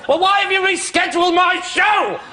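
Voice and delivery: high, drawn-out indignant screech; Anne Robinson. Retold or imitated. TV presenter confronting supposed scheduler about her show, indignant mimicry. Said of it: "She talks like that".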